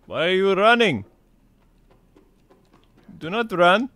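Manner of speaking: put-on high, rising calls in Russian accent. Ordering someone to stop running, high Russian accent. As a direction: call after a runner as a mock Russian. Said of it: "I just happen to like Russian accent".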